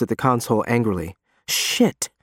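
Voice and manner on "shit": high-pitched exasperated swear; angry curse. A narrator's reading. Fiore stabbing at the console, angry outburst. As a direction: spit out the curse, higher.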